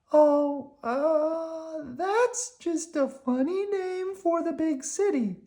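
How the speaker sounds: high squeaky worm; high, cheerful little worm. Acted. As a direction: pitch it up like a tiny worm.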